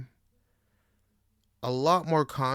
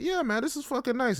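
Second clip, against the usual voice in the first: excited; 13 semitones higher, faster than usual. Retold or imitated. Own past self quoted.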